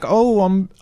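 a quick jokey British posh voice; mock British accent. Mocking old British talk with a hammed-up British accent. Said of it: "this classic British accent".

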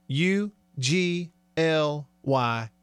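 each letter slow and separate; drawn-out letters to drive the verdict home; emphatic spelling. Real, not acted.

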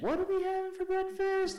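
mock saying everything like a catchphrase; high, loud affected sing-song; sing-song inflection. Shown as a demonstration. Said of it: "said everything in that inflection".

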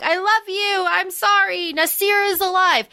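high-pitched mock tearful plea; mock crying. Possessed lover apologizing to his mate, mock crying. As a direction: whimper it as a put-on sob story. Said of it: "He's, like, crying".

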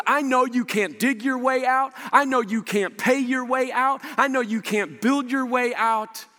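impassioned preacher voicing God's compassion; compassionate insistence. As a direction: press each line home with compassion. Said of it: "He sees you and I in our sin".

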